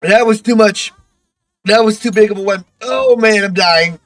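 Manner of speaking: sputtering and wheezing from a bad inhale, real; choke, laugh and gasp between words; coughing and laughing fit. Real, not acted. Streamer to chat after inhaling too much, coughing and laughing for real. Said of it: "that inhale was a little too much".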